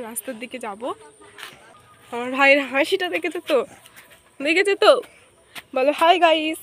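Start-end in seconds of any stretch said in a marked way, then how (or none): 2.1-4.9 excited. Real, not acted. Excited showing baby brother on camera. 5.7-6.6 excited. Real, not acted. Coaxing little brother to greet.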